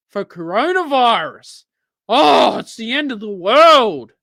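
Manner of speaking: loud, high hammed-up alarm; mock panic. Mocking government's alarm over coronavirus, hammed-up mock panic.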